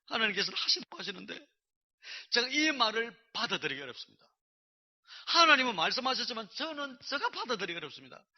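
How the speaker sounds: loud, high, insistent pleading; acted objection. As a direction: push back on God at full voice. Retelling his objection to God.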